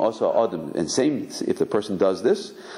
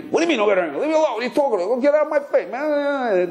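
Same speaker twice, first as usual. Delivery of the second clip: angry retort. Retold or imitated. Angry man snapping at someone who tells him not to get angry. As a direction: bristle at being told to calm down. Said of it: "tell somebody who's angry".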